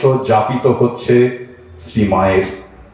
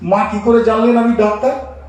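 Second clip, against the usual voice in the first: higher-pitched, wondering question, rising at the end; retold startled surprise; 9 semitones higher than usual. Doctor startled that Mother knows his profession, mildly acted surprise. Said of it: "ইনি চমকে গেলেন".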